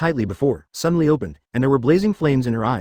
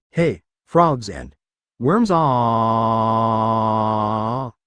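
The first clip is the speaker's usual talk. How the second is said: drawn-out call; 2 semitones lower, much slower than usual. Acted. Opening call repeated, drawn out.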